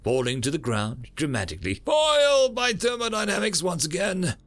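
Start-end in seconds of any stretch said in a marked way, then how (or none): 1.8-4.4 hammy dying hero, loud; overacted theatrical defeat; melodramatic defeat. Falling dramatically.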